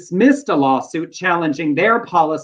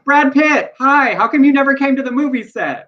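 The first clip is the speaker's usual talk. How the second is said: "Brad Pitt, hi" much higher and louder, gushing; excited quote; 9 semitones higher, 3 dB louder than usual. Retold or imitated. Retelling an actress greeting Brad Pitt, excited gushing. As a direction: gush at the star with a laugh in the voice. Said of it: "she got up there and said".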